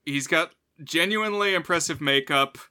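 ordinary talk, nothing marked

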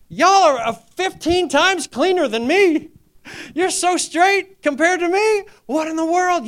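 mock amazement at churchgoers; incredulous disbelief. Voicing his reaction to church people.

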